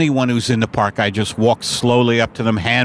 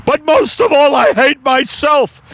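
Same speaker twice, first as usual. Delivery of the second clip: high, loud anguished scream; hammed-up breakdown yelled through a door; unhinged shouting; 12 semitones higher, 4 dB louder than usual. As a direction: wail it out in mock despair. Acts unhinged customer screaming at courier.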